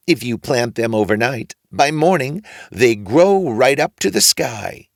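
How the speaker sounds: put-on odd old man approving the boy. A narrator's reading. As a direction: draw it out knowingly. Old man tempts Jack.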